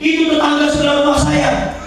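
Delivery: high, insistent denial; upset customer. Retold or imitated. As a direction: push back sharply, voice way up. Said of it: "kata dia". (suara ibu yang kesal, nada tinggi)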